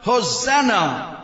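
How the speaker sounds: loud slow shout; shouted hosanna. Shown as a demonstration. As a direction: call it out like the crowd. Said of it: "all the adults together shouted".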